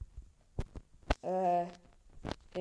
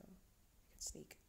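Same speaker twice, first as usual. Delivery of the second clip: whispering; 18 dB quieter, much slower than usual. Real, not acted. Sneaking up in game.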